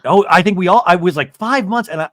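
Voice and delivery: ordinary talk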